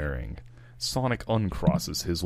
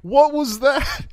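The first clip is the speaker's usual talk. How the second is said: high, loud, laughing disbelief; incredulous laugh; 10 dB louder than usual. Real, not acted. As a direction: laugh out the question. Laughing at the bad yawn.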